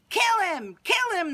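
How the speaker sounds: mob yelling. Acted. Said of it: "the people yelled".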